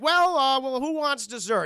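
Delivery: high, loud put-on mom voice; hostess mom. Mom offering dessert at the table, put-on hostess voice. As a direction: chirp it out as a mock hostess. Retold mother at awkward dinner.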